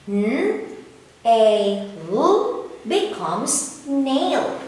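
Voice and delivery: slow blended phonics, each sound held; blending sounds. Shown as a demonstration. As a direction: sound out N A L slowly, then say "nail". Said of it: "Again, kids".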